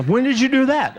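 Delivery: lightly hammed-up wife in disbelief; wife's startled question. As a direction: voice the wife, high and incredulous. Wife voiced in retold story.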